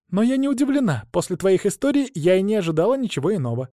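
lightly voiced girl, fond; young dragon girl.

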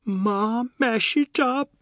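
high, loud tearful wail; crying afresh. Acted. Daughter crying to father about the broken house, tearful wail. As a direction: wail it out through fresh tears. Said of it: "made Savi cry afresh".

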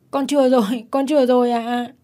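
put-on high-pitched, wretched child's wail of pleading; acted miserable begging; pitiful child's cry. A narrator's reading. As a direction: wail out the plea as a small boy. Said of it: "miệng vẫn gào thảm thiết".

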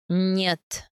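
a curt low no, forced out between clenched teeth; through teeth. A narrator's reading. Ayaz answers his wife's question curtly: through teeth. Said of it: "сквозь зубы ответил Аяз".

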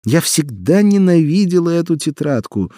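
high, loud distant shout from the kitchen; mother's voice carrying through a wall; from another room. Acted. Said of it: "услышала она материн голос из кухни".

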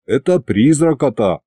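ordinary delivery

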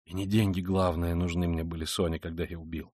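low, soft, subdued admission; brooding confessing man. Acted. Killer confiding in Sonya, brooding and hushed. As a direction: confess quietly, pitch sunk low.

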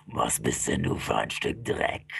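very low, slow monster voice; deep, menacing fiend; furious demon. Acted. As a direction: growl the insult deep and slow. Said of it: "Wutentbrannt starrt er mich an".

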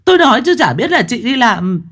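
mother-in-law bursting in to berate her son's wife, shouting; shrill scolding. Acted. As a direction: tear into the daughter-in-law at full volume. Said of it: "Bà xông vào quát lên ầm ĩ".

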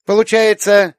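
put-on high, loud child's voice; small girl working it out, pitched high; little girl reasoning. A narrator's reading. Little girl works out who is home, reasoning.

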